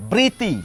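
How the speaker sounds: বৃতি said as briti, one t; first step of the drill; step one demo. Shown as a demonstration. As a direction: start with plain বৃতি. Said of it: "ধাপটা একটু দেখানোর চেষ্টা করি".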